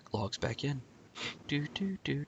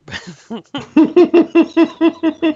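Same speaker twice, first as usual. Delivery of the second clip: high squealing giggle; 11 dB louder than usual. Pretend. Cooing over Gail on camera.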